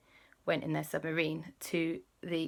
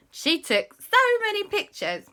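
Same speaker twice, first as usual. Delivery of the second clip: ordinary delivery, nothing marked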